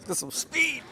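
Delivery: high-pitched thrilled exclamation; excited whoop. Real, not acted. Reviewer enjoying the mower's speed, excited high cry. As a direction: call it out high, riding a burst of speed.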